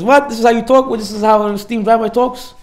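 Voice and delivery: indignant retort. Acted. Offended man retorts in story.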